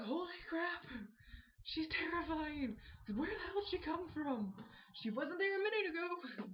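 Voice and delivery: high, loud panicked outburst; terrified. Retold or imitated. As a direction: freak out at the ghost. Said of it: "you're like".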